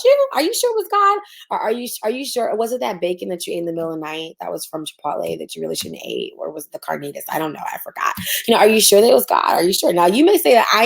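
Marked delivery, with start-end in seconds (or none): 2.5-8.1 sarcastic aside. Real, not acted.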